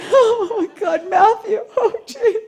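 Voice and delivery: high loud hammed-up crying through laughter; fake sobbing. Playing the grieving mother at the show, hammed-up crying. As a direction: fake a tearful outburst, laughing underneath. Faking grief at psychic show.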